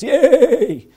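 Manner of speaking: mock ballpark cheer; fan cheer. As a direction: whoop it like a Yankees fan. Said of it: "Other family goes".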